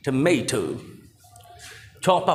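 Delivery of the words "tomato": tomato the American way, "tuh-MAY-toh"; American pronunciation. Shown as a demonstration. Teacher contrasts how American people say it, American "tuh-MAY-toh". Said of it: "while the American people pronounce it".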